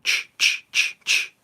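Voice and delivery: unvoiced scratchy ch ch like flint striking; flint strike noise. Viewer asked about striking flint, mimicking sparks with breathy chs.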